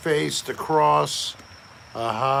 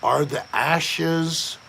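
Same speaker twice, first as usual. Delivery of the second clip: slow, drawn-out quiz question; quizzing; 2 semitones lower, much slower than usual. Real, not acted. Testing listeners on church ways, quizzing slowly. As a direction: stretch each word out for the class.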